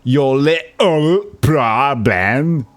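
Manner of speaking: slow, drawn-out British accent impression; mock British accent. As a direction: stretch it out in a hammed-up British accent.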